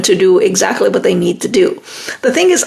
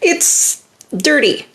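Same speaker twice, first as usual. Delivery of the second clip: usual speaking voice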